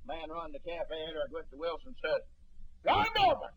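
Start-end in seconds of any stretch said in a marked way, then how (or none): 2.8-3.5 calling out. Retold or imitated. Cafe man calls to him.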